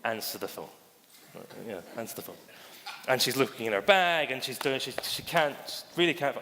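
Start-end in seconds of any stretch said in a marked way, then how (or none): 0.0-2.4 soft, low muttered thought; quiet, low voice in one's head; inner thought. Retold or imitated. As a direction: say it under your breath, pitched down. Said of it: "internally, I think".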